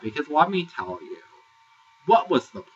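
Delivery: ordinary talk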